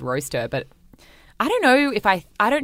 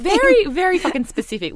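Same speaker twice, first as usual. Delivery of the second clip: exasperated shout; 7 dB louder than usual. Real, not acted. Emphatic exclamation.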